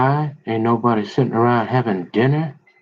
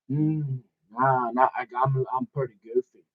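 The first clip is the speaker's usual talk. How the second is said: sheepish mumble; 8 dB quieter than usual. Retold or imitated. Reply voiced in comic dialogue.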